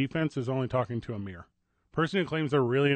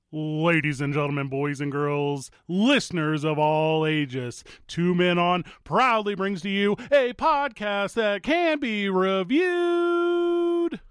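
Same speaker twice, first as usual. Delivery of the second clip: slow, drawn-out ringmaster call; 3 semitones higher, much slower than usual. Acted. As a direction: announce it like a circus ringmaster. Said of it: "ringmaster style intro".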